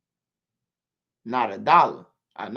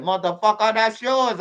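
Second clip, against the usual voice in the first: louder, higher, angry accusation; angry accusing driver; 5 semitones higher, 5 dB louder than usual. Retold or imitated. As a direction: hurl the blame back at him. Cab driver accuses in retold scene.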